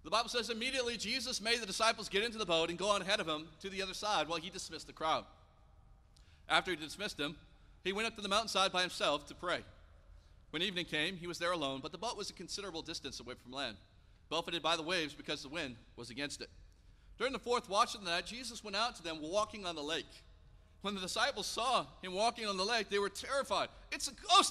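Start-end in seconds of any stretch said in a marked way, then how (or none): 0.5-23.7 a bold, raised reading voice; scripture reading. Acted. Reads the scripture passage aloud. 23.9-24.5 mock fearful cry. A narrator's reading. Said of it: "they cried out in fear".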